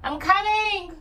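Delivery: shouting to someone in another room; calling out. Real, not acted. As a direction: call out loud and high. Calling out to family.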